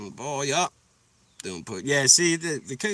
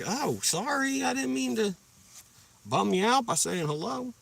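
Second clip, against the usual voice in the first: high-pitched, put-on meek apology; mock apology; 12 semitones higher than usual. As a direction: apologise sarcastically in a high little voice. Mock apology to rude locals.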